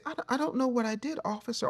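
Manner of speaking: high, soft, fast meek plea; suddenly soft. Retold or imitated. Pulled over, pleading to the police officer, meek and soft. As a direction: shrink into a small innocent voice for the cop. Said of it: "you can get real soft".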